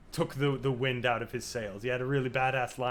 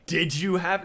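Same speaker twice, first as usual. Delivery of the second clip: louder, higher taunting correction; mocking jab; 6 semitones higher, 8 dB louder, slower than usual. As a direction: twist the knife, mock-cruel. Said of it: "Or should I say".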